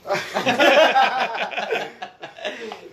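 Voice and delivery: loud burst of laughter. Real, not acted. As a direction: laugh out loud at own joke. Joking he has to leave.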